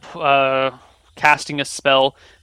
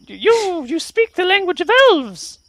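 high squeaky astonished fey; high tiny creature amazed; amazed brownie; 3 dB louder than usual. Acted. GM voices brownie.

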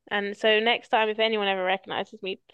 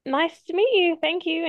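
mock polite; 8 semitones higher, 4 dB louder than usual. Her polite reply to stranger.

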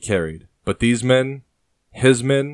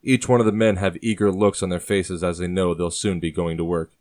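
usual speaking voice

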